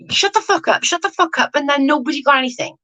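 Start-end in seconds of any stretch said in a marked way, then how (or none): 0.1-0.8 exasperated retort. Retold or imitated. Acted angry retort. 0.8-1.5 exasperated retort. Retold or imitated. Retort repeated.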